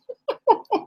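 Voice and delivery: a sudden high-pitched giggle; short high laugh at the joke. Real, not acted. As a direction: giggle at your own joke.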